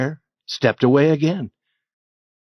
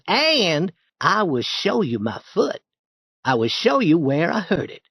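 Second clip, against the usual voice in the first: put-on high, friendly boyish coaxing; persuasive boy Tom; 8 semitones higher than usual. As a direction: talk him round, all charm.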